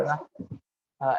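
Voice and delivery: normal talking voice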